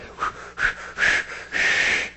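forceful Lamaze-style breaths; deep sigh demonstration. Shown as a demonstration. Preacher demonstrates Jesus' deep sigh, like childbirth breathing. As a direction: puff out hard breaths, squeezing something out. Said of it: "with a deep sigh".

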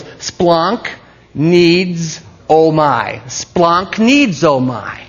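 "Splonk needs oh my" in long, separate pieces; pronunciation demo. Shown as a demonstration. Preacher teaching funny Greek to listeners, slow exaggerated demonstration. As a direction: break up "Splonk needs oh my" and stretch every part. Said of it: "It goes".

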